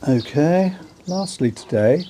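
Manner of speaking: ordinary talk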